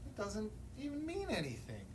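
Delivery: usual speaking voice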